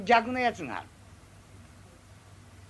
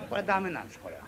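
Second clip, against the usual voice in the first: ordinary delivery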